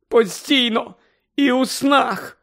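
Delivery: high, slow, tearful wail; sobbing. Acted. Mother sobbing on Lupin's shoulder, high. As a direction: cry it out.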